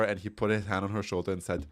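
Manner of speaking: ordinary talk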